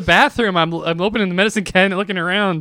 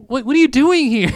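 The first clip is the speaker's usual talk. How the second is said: shocked question; 7 semitones higher, 3 dB louder than usual. Retold or imitated. Shocked at intruder.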